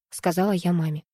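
usual speaking voice

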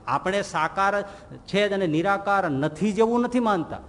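usual speaking voice

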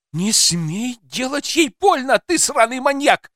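high-pitched, outraged yell; furious yelling. Acted. Mother warning the man not to hurt her daughter, furious yelling.

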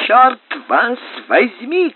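high, loud and slow angry shout. A narrator's reading. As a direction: yell it through the door. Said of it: "кричал кто-то за дверью".

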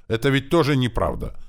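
high, loud protest; indignant. A narrator's reading. Boy answering the orc's tale about him, indignant. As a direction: object with offended force. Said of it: "Я возмущённо посмотрел на него".

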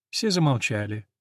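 ordinary delivery, nothing marked

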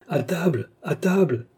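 mock crowd cry at moderate volume; called out. A narrator's reading. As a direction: call the guests in, voice pitched well up. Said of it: "criait-on en même temps de toutes parts".

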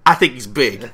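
loud put-on cartoon voice; dopey character voice. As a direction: blurt the obvious as a goofy character. Co-host repeats acted dumb line.

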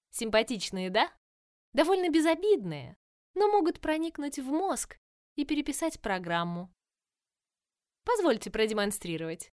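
loud, gleeful taunting villain voice; mock the heroes with cheerful menace; playful villainess. Villain Virus speaks.

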